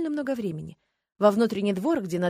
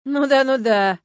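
хмыкнув; 6 semitones higher, 9 dB louder, slower than usual. A narrator's reading. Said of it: "хмыкнул дядюшка Вас".

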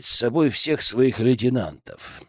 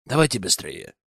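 careless. Acted. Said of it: "бросил он небрежно".

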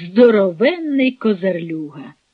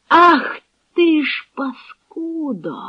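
high, slow, drawn-out curse; outraged curse; 6 semitones higher, slower than usual. A narrator's reading. Cossack grabs the captive by the collar, outraged. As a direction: stretch the insult with rising outrage.

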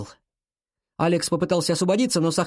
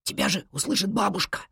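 hushed, high-pitched warning; alarmed whisper; frightened whisper; 4 semitones higher, 4 dB quieter than usual. A narrator's reading. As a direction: warn urgently without being overheard. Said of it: "испуганно прошептал он".

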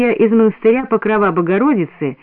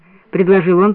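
ordinary delivery

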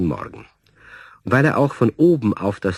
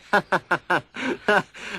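chuckle; 11 semitones higher than usual. Acted. Neighbour laughs at teasing.

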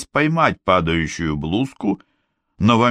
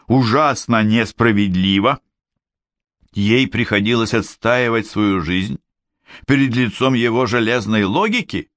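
ordinary delivery, nothing marked

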